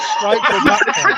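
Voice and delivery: a real laugh breaking through the words. Real, not acted. Laughing at his own story.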